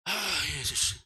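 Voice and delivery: low, groaning sigh; agonised prayer. Retold or imitated. Failing driver calls on Jesus, agonised groan. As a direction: moan the name, nearly spent.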